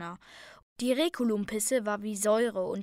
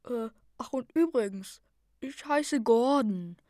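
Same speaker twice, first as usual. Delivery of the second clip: lightly voiced orc; 2 semitones higher than usual. A narrator's reading. Orc introduces himself on deck.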